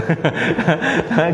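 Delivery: short amused laugh; brief real laugh; chuckle. Real, not acted. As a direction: laugh at the joke. Teacher laughs at the joke.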